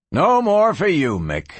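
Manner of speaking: firm warning, starting high and dropping. A narrator's reading. Lawman to a drunken miner in the saloon, warning. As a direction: warn him off, high then falling on the name. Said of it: "the lawman warned".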